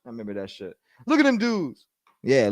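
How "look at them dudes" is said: high mocking Church voice; imitating Church. Quoting Church to the chat, mocking. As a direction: raise the pitch and sneer at them dudes. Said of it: "Church would go".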